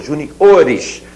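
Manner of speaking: "juniores" stressed on the O; ju-ni-O-res, teacher modelling "juniores"; correct stress demo. Shown as a demonstration. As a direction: land hard on the O in "juniores". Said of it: "Essa é a pronúncia".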